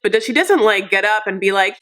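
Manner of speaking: ordinary delivery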